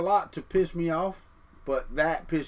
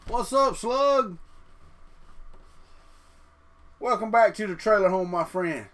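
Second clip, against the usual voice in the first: pet greeting; 5 semitones higher, 4 dB louder than usual. Real, not acted. Greeting pet slug on camera.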